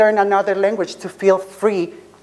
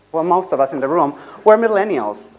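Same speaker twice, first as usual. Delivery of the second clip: usual speaking voice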